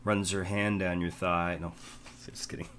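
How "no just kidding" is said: whispered aside. Real, not acted. GM backs off joke.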